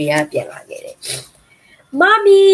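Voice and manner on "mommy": high, loud joyful call of Mommy; baby owls calling their returning mother, high and loud; joyful cry. Acted. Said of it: "they cried".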